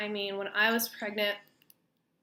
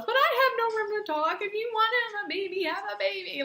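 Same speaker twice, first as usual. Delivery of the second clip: mom's reply; 13 semitones higher, 5 dB louder than usual. Retold or imitated. Mom in the car accepting it, high voice.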